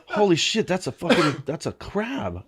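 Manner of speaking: high-pitched reenacted astonishment; retold shocked discovery. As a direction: act out realising what you've found. Acts out recognising the crab.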